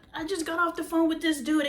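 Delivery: ordinary talk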